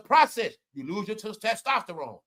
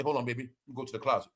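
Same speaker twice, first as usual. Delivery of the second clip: put-on much lower and softer aside to baby; mock husband; 9 semitones lower, 8 dB quieter, much faster than usual. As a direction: pretend you're stalling her. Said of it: "you gonna say".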